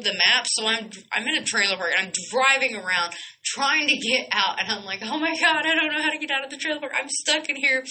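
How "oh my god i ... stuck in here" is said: high-pitched, rushed panicky replay; panicked high voice, played up; mock panic. Driver lost in a trailer park, mock panic.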